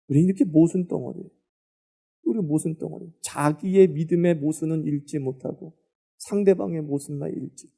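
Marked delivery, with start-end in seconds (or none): none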